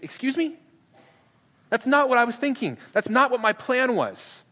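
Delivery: high-pitched mock outrage, slowing at the end; shocked objection. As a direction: recoil at a plan you hate. Peter shocked by Jesus' plan.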